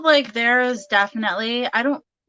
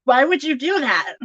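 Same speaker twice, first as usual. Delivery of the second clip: amused mock reproach; 5 semitones higher, 4 dB louder than usual. Acted reproach, laughing at it.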